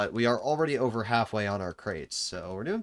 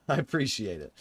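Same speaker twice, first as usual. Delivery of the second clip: usual speaking voice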